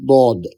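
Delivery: "দদ" with the tongue's side against the upper molars; heavy Arabic Dad in "দদ", tongue edge on molars. Shown as a demonstration. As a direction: press the side of the tongue on the back teeth for "দদ". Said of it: "দাঁতগুলার সাথে লাগিয়ে উচ্চারণ হবে".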